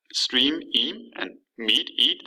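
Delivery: "stream", then its ending "eam" alone, "mead", then "eat"; word, then its rhyming tail, spaced apart; isolated sound demo. Shown as a demonstration. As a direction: echo just the ee ending after "stream" and "mead". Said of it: "They have the same sound to them".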